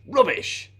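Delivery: high dismissive exclamation. Shown as a demonstration. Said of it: "one dismissive word".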